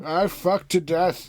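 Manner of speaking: high, drawn-out mock death cry; slow, high mock farewell; dying old man's last words. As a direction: draw it out in hammed-up agony. Joining in as dying elder.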